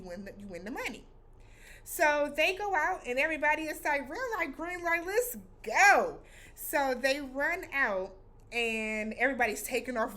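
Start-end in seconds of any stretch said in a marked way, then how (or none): 4.1-6.2 high, eager cheer building to a loud "go"; rally the crowd to start the race; hyped-up players, loud on the last word; excited crowd. Retold or imitated.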